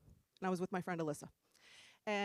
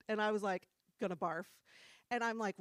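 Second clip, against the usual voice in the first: usual speaking voice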